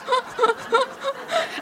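high breathy laugh near tears; real laugh that tips toward crying. Real, not acted. After telling husband's punchline, breathy laugh near tears.

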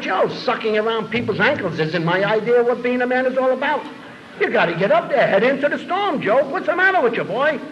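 high, bold mocking sermon to a friend; high cocky dare; mock taunting speech. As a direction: goad him like a swaggering preacher. Brian taunting Joe in retold exchange.

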